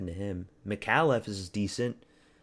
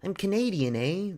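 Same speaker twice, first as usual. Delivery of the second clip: much higher hammed-up Canadian accent with a bouncy eh; mock Canadian accent; 9 semitones higher than usual. Streamer joking with Canadians in chat, hammed-up Canadian accent. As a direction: play a cartoon Canadian, eh.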